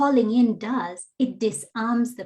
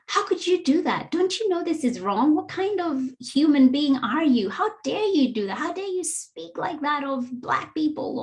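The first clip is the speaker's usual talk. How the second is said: higher, indignant scolding; accusing call-out; 7 semitones higher than usual. Shown as a demonstration. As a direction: berate her like a ton of bricks. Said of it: "you're not leading with".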